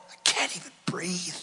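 betrayed spouse collapsing after crying out; broken whisper. Acted. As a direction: let the grief collapse into a whisper. Anguish fading to whisper.

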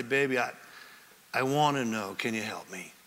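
low, soft, halting plea; sincere, gentle request. Shown as a demonstration. Husband asking wife to help him understand, sincere and gentle.

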